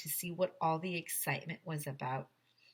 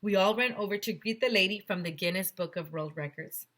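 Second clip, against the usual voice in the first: plain reading voice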